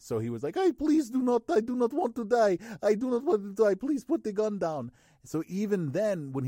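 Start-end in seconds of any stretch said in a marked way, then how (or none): 0.5-4.9 put-on loud, high-pitched begging in a Russian accent; pleading Russian hacker. Russian hacker whose life is threatened, pleading in Russian accent. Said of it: "a Russian hacker, and his life was being threatened".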